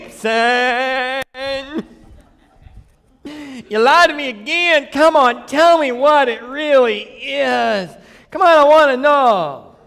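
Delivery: high put-on woman's voice, whining; girl voice. Delilah whining at Samson for the truth in a high girl voice. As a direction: whine it in a high girlish voice. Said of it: "I can't even do the girl voice".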